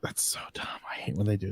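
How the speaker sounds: whispered grumble. Real, not acted. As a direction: mutter it under your breath in annoyance. Grumbling aside about online-only.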